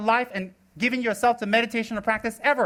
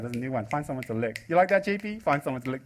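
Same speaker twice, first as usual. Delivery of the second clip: normal talking voice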